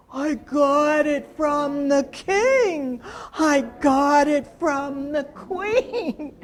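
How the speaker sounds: slow, loud, strange alligator drawl. Shown as a demonstration. Storyteller showing children an alligator voice, slow and loud. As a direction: drag it out like a lazy alligator. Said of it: "Make an alligator voice?".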